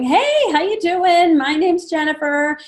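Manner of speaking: high, loud cheery hello; bright greeting. Retold or imitated. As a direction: greet the newcomer with a burst of cheer.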